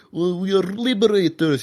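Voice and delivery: high-pitched put-on propagandist voice; mock Russian liberator. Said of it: "the Russians always talk like this".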